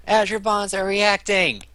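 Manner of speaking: high, loud, drawn-out alarm call; acted warning shout. Character playing on guards' fears of Azure Bonds, shouted warning. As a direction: call out slowly to alert everyone.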